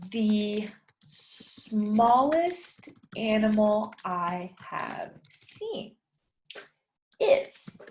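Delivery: very slow, careful prompt; slow prompt reading. Shown as a demonstration. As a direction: read the prompt slowly for learners. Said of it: "teacher reads the prompt slowly".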